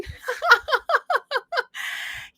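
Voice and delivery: long, high giggling laugh; real high-pitched chuckling; real laughter. Real, not acted. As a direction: giggle in a high run of short bursts. Laughs at her own remark.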